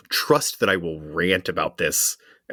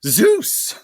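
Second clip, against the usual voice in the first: high, loud mock cry; mock exclamation; 6 dB louder than usual. Quoting Hercules as he gets kicked, mock exclamation. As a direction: cry out like a kicked comic-book hero. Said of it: "his exclamation is".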